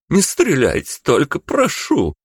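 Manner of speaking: put-on high, pleading old woman voice; frightened pleading. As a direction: beg not to be shot. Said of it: "испуганно воскликнула она". (испуганная мольба старушки)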